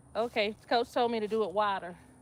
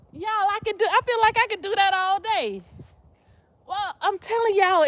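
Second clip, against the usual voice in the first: excited; 9 semitones higher, 3 dB louder than usual. Real, not acted. Excited after squats.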